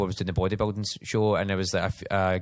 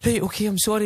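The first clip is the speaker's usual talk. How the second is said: nervous apology; 10 semitones higher than usual. Retold or imitated. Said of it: "And I'm like".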